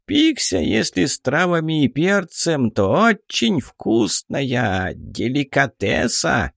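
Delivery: high, slow gloating duergar; a hungry dwarf chief relishing a meal. Acted. Duergar savours the pixie.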